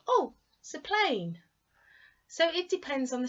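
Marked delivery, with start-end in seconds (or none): 0.1-1.4 higher, louder casual oh; casual noticing. Retold or imitated. Someone outside noticing a plane pass overhead, casually. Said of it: "we just go".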